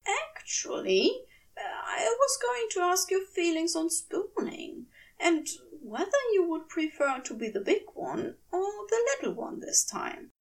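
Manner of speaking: coy whisper, testing the waters; breathy shy angel. Acted. Angel shyly asking about spooning, half-whispered.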